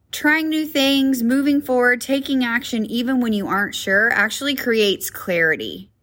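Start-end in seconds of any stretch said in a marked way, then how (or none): none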